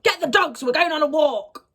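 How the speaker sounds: loud, high retold yell; mum shouting. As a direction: imitate mum yelling an order. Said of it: "shouted to my dad".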